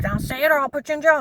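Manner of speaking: reenacting a screaming officer; retold screaming. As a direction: scream it like the cop did. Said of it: "He starts screaming again".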